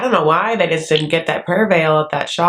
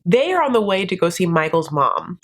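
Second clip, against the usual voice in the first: ordinary talk, nothing marked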